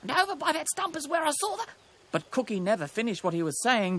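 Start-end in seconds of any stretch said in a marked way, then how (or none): 0.0-1.6 high, quick bird voice, cut off; high, anxious kookaburra; kookaburra character. A narrator's reading. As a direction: hurry along and break off.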